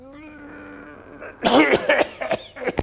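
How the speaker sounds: a weak, rattling patient's cough; imitated sick cough. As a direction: cough like a man with pneumonia. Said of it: "He's talking like".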